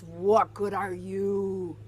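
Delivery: slow, sulky pirate grumble; slow grumpy question; grumpy Gus. Acted. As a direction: drag it out like a sour old pirate. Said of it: "He asks".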